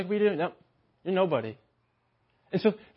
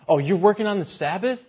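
judgmental mock scold; 6 semitones higher, 4 dB louder than usual. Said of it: "this came up".

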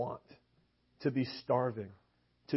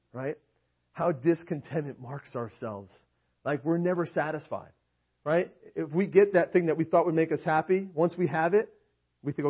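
ordinary talk, nothing marked

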